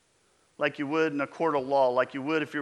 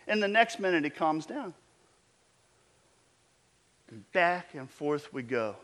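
usual speaking voice

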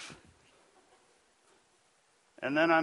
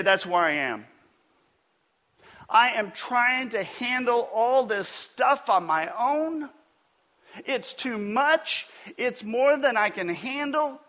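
overwhelmed confession; 7 semitones higher, 3 dB louder than usual. Retold or imitated. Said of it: "you would say".

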